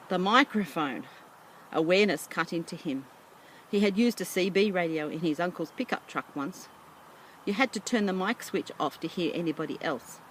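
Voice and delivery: ordinary delivery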